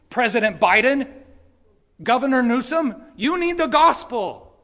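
loud, high, urgent preaching; impassioned yelling. Real, not acted. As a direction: plead at full voice with the congregation. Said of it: "sounds like I'm yelling at you".

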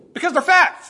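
loud, high punchline; emphatic. Real, not acted. Preacher to congregation, emphatic declaration. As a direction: hammer the point home.

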